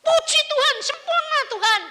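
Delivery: very high shouted praise; ecstatic praise. Retold or imitated. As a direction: scream thanks to God. Memerankan seruan syukur orang sembuh. (pujian diteriakkan melengking)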